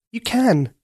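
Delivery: put-on high, earnest young girl; a girl pleading to save the prince. A narrator's reading. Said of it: "said Katia".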